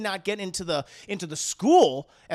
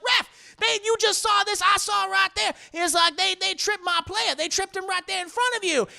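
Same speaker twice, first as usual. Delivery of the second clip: much higher, louder pleading protest; high indignant coach protest; 12 semitones higher, 4 dB louder than usual. Retold or imitated. Coach Cotton complaining to the referee, high indignant protest. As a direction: squeak out outrage at the ref.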